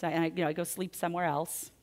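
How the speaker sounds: ordinary talk, nothing marked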